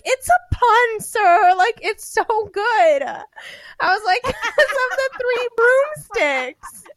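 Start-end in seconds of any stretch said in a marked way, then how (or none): 0.0-3.0 high, loud, insistent defence of the pun; excited pleading. Retold or imitated. 4.2-7.0 real giggles breaking the words; can't hold back the laughter; cracking up while explaining the pun; laughing through it. Real, not acted.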